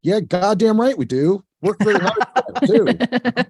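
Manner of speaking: high, loud laughing brag; laughing boast. Real, not acted. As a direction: crow it out with a laugh, proud.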